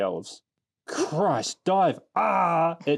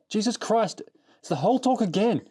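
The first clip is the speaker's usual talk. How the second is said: exasperated reading; 8 semitones higher than usual. Retold or imitated. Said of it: "read parts of it out verbatim".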